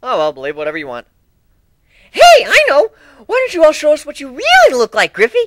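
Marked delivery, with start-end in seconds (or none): 2.1-5.4 much higher, louder, bubbly pony voice; Pinkie piped up. A narrator's reading. Pinkie pipes up to the whole group, higher and louder. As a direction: chirp it out, eager and bouncy. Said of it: "Pinky piped up".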